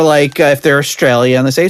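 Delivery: usual speaking voice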